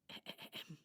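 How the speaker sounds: small, soft preparatory ahem; throat clearing. Acted. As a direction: clear your throat before singing. Doll prepares to sing.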